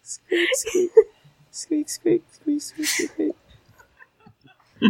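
high, soft whispered squeaks; mouse squeaks. Acted. Faking a mouse behind the guard, high soft squeaks. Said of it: "project a noise of a mouse".

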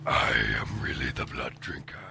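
low, far, hushed whisper. Retold or imitated. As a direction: breathe it low and menacing. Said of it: "I whisper to him".

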